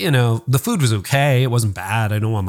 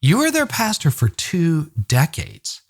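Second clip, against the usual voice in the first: a lightly voiced wife, higher and slower; patient reasoning; 5 semitones higher, slower than usual. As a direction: gently remind him of the obvious. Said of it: "she goes".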